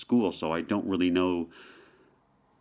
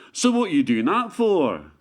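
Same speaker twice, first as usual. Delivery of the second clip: higher, louder Scottish local asking bluntly; Scottish question; 7 semitones higher, 5 dB louder than usual. Retold or imitated. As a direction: imitate a Scot's suspicious question. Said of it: "Well, deceptive, because at first you might think".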